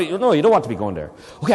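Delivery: normal talking voice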